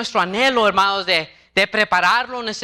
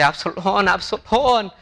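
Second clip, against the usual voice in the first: crying father; slower than usual. A narrator's reading. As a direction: murmur the name through held-back tears.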